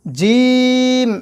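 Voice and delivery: জিম held long and sung out; drawn-out Arabic letter. Shown as a demonstration. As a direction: stretch জিম slowly like a recitation. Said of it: "যখন বলবেন জিম". (জিম টেনে লম্বা করে উচ্চারণ)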